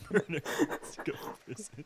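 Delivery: whispered verdict; a whispered mock accusation. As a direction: breathe it out as a secret. Joking verdict aside.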